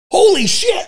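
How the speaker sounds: high, loud amazed cry; retold shocked exclamation. Retelling the academy class, he voices the instructor's high shocked outburst. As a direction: blurt it as a stunned instructor. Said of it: "the instructor goes".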